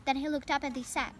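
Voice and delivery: plain reading voice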